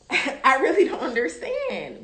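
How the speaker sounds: laughing through the words; giggling in disbelief. Real, not acted. Laughing at the dog.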